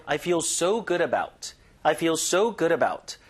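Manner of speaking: "so" drawn out with extra weight; stress on so. Shown as a demonstration. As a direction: lean on "so" each time. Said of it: "요거 so에 힘주고". ("so"에 힘주어)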